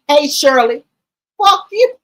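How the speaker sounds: hollering at a rival by name; mock call out. Calling out to Shirley, then a mock curse. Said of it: "calling Shirley, talking about".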